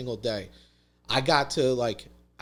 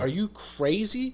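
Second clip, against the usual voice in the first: higher and slow, dragged out in disbelief; incredulous father; 8 semitones higher, slower than usual. Retold or imitated. Dad to his son about the wrecked house, slow shocked disbelief. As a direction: let the disbelief stretch each word.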